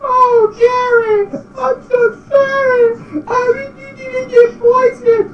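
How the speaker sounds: very high, squeaky mock crying; hammed-up falsetto lament; tearful SpongeBob. A narrator's reading. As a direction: whimper it way up high. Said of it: "Tears started dripping from his eyes".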